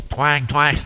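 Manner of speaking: loud mimicked guitar twangs; imitating twangy guitar. Shown as a demonstration. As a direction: punch out each twang like a plucked string. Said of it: "twangy sound".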